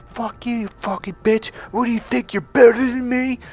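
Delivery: high-pitched, imitated indignant tirade; retold angry outburst. Retold insult at woman walking away.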